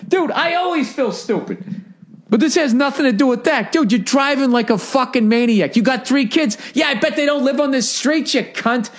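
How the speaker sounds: high, worked-up imagined comeback; mock comeback.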